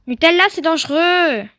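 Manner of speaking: loud warning call to the horse; loud call to a horse in danger. Real, not acted. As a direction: yell the warning across the field. Calling the runaway horse.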